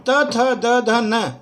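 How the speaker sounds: touch the teeth with the tongue for "त, थ, द, ध, न"; dental letters demo. Shown as a demonstration. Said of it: "इसका उच्चारण का अभ्यास करें आप लोग". (दांतों पर जीभ रखकर "त, थ, द, ध, न" ऊँचे स्वर में)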